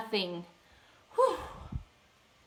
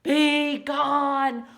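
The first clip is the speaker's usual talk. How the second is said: loud, somewhat higher roar; louder demo roar for the child; 4 semitones higher, 8 dB louder than usual. Shown as a demonstration. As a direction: show the child how to roar. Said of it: "Can you roar?".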